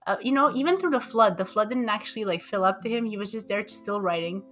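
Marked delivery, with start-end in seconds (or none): none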